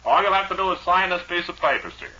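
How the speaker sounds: loud, high pushy bank man; pushy banker. Acted. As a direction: lean on him like a mortgage collector.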